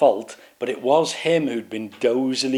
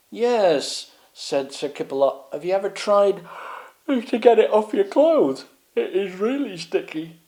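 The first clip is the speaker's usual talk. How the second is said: dozy knight; 8 semitones higher than usual. Acted. Kipperlot warns.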